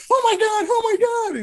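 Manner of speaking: very high, loud freak-out; imitating a hysterical shriek; going nuts. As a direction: squeal it like an overwhelmed fan. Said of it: "going nuts".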